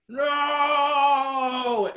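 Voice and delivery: hammed-up sung wail of grief; anguished cry. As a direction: belt out a mock-tragic no. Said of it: "He's like".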